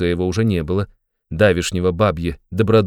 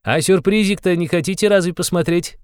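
high, playful tempting voice; impish, high-pitched question; giggly teasing; 11 semitones higher than usual. A narrator's reading. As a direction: dangle the surprise mischievously. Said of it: "захихикал Порфирий".

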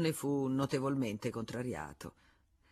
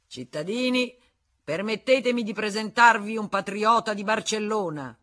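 raised, loud announcement; public address; 6 semitones higher, 8 dB louder than usual. Acted. Régimbard presenting the Spaniard to the assembly, raised and loud. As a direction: present him to the hall.

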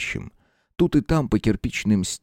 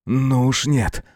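higher, indignant outburst; indignant shout; 5 semitones higher, slower than usual. Acted. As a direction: cry out in refusal. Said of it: "негодующе выкрикнул".

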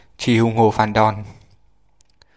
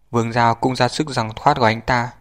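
plain reading voice

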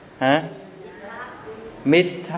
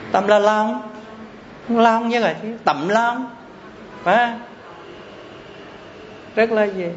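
animated questioning; 7 semitones higher than usual. Real, not acted. Teacher lively questioning class.

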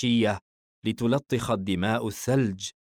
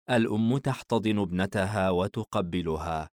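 plain reading voice

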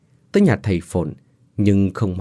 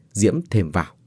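ordinary delivery

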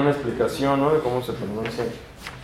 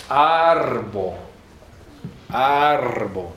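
ARbo, first syllable long and falling; penultimate stress; much slower than usual. Shown as a demonstration. Teacher showing Esperanto's accent rule, stretched AR in Arbo. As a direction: lean on the AR of Arbo. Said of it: "Con pronunciación grave".

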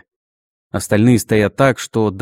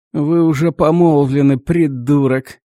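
high, slow, creaky clerk's sneer; creaky notary voice; 5 semitones higher, 3 dB louder than usual. A narrator's reading. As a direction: creak it out like a dry old notary. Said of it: "скрипучим голосом нотариуса произнёс Лёха".

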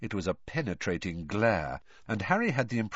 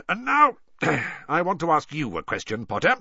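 louder, higher, throat-clearing change of subject; Moody, businesslike; 6 semitones higher, 5 dB louder than usual. A narrator's reading. Moody turning to question Harry, businesslike. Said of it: "in a more businesslike tone".

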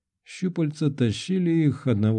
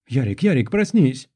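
urgent waking call; 3 dB louder than usual. A narrator's reading. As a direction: try to rouse a sleeper from a nightmare. Alisa wakes him from a nightmare. (настойчиво будить, повышая голос)

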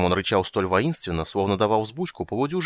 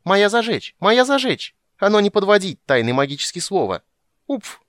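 high, loud gleeful boasting; excited squealing in a high voice; delighted squeaking; 11 semitones higher, 6 dB louder than usual. A narrator's reading. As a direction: crow like an overjoyed little creature. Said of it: "в восторге пищала Бупу".

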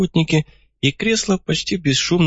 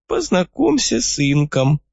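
put-on old man voice; 7 semitones higher than usual. A narrator's reading. Old man John introduces.

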